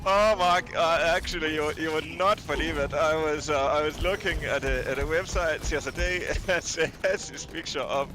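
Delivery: put-on lilting comic German accent, slipping into Danish. Telling Mark about a kitten picture, German-Danish accent. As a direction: play an amused German with a Danish tinge. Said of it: "mixing in some Danish accent now".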